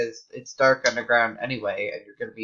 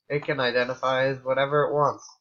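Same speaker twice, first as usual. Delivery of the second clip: normal talking voice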